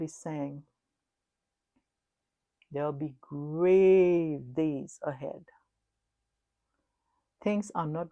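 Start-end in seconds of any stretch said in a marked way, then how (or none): 2.7-5.4 very slow and louder, each word weighted; grave warning. Acted. Warning the congregation of grave days, solemn slow. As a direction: lay down the warning like a verdict.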